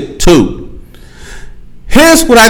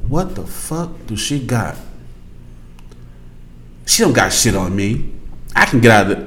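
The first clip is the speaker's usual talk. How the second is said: low cocky scoff; 10 semitones lower, 10 dB quieter than usual. Retold or imitated. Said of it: "The guy's left like this".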